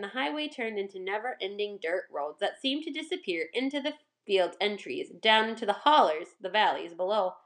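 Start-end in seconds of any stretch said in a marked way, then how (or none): none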